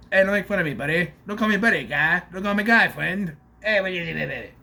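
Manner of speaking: bickering in a cartoonish Canadian accent; mock Canadian accent. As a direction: trade silly retorts in a put-on Canadian accent. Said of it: "she sounds Canadian".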